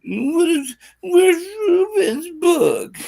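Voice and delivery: high drawn-out whining; whiny high voice. Acted. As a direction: whine it like an impatient fan. Imitating impatient fans, high whine.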